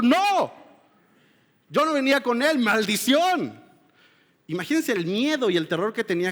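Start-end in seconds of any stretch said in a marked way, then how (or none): 0.0-3.6 a high, loud, slow-ending protest; cursing denial. Retold or imitated. Peter denying Jesus to the crowd, loud cursing protest. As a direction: deny him hotly and spit out the curse.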